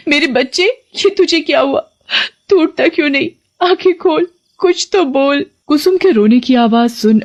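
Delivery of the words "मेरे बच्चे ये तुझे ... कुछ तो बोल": high, wailing cry over her son; a mother sobbing for her child. Acted. Mother finding her son lying at the door, wailing high. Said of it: "जोर से चिल्ला पड़ती है".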